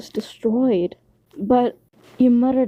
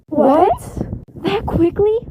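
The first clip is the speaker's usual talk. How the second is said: high, loud shocked outburst; surprised; 10 semitones higher, 3 dB louder, slower than usual. A narrator's reading. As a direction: squeal it in disbelief. Nami and Usa surprised.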